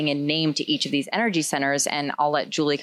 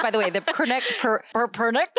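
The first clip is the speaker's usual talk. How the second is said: higher, laughing and stumbling over the word; tongue-tied, cracking up mid-word; 6 semitones higher than usual. Real, not acted. Co-host botching "pronunciation" in an aside, laughing.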